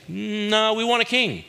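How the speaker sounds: high, whiny refusal; stubborn crowd. Retold or imitated. The people refuse Samuel, high and whining. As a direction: dig in like a stubborn child.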